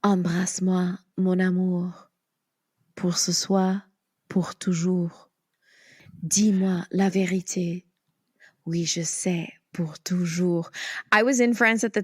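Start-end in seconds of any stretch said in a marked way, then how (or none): 0.0-10.7 measured spoken French with long pauses; French recitation. Shown as a demonstration. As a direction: recite tender French lines unhurriedly in a French accent. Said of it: "Can you say that for us".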